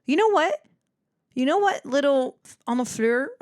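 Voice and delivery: high, loud sassy comeback; a high teasing you-know-what; high mock retort. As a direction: pitch it up high and snap back playfully.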